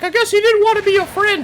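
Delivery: very high, loud cartoon falsetto; Mickey Mouse. Cartoon mouse after the door is shut on him, high falsetto. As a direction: squeak it as a rebuffed cartoon mouse. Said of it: "Mickey Mouse is like".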